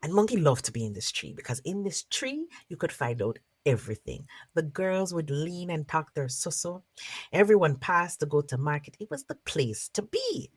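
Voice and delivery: lively storyteller narration; story narration. Acted. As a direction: tell it to a circle of listeners. Storyteller narrates folk tale.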